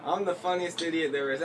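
usual speaking voice

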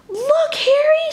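high, slow proud call; proud Jack shows Harry his nest; proudly. Acted. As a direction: show off to your friend. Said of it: "said Jack so proudly".

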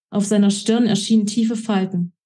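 usual speaking voice

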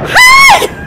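very high loud yelp; sharp frightened cry; startled yelp. Real, not acted. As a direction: yelp as the shark comes. Shark charges.

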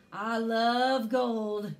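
drawn-out loud giant cry of greed; shouted. Acted. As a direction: bellow it out slowly like a greedy giant. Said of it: "the giant shouted".

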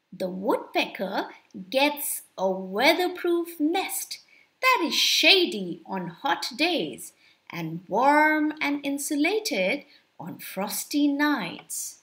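plain reading voice

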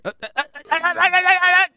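machine-gun yelps rising in volume; rapid panicky yelping. Pretend. Player under demon attack, high stuttered yelps.

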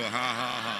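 a deliberate fake ha ha ha; demo fake laugh. Shown as a demonstration. Preacher shows the congregation a fake laugh.

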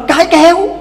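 high and loud stubborn retort; wife refusing to give in to her husband, defiantly. Acted. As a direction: fling it back in defiance. Wife turns back, defiant.

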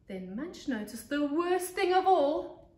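put-on lively read-aloud voice for young kids; storybook narration. A narrator's reading. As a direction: tell it like a teacher to her class.

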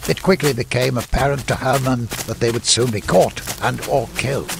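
ordinary delivery

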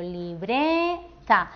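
"libreta" very slow, BRE held long, then TA; stress on middle syllable. Shown as a demonstration. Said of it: "Damos mayor intensidad a esta sílaba". ("libreta" lento, alargando la sílaba BRE)

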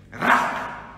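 unvoiced, rasped insult; imitating a scornful singer. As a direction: spit out the insult. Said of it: "se acerca con alguien y le dice".

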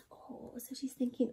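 unvoiced hushed aside; soft storyteller whisper. Real, not acted. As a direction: drop to a secret whisper.

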